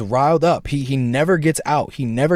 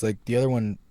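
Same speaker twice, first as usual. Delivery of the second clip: ordinary talk, nothing marked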